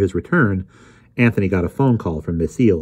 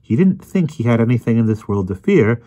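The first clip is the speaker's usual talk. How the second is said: higher-pitched, self-assured lecturer; Emerson's know-it-all voice; 7 semitones higher than usual. Acted. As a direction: explain it all with smug certainty. Voices Emerson explaining smugly.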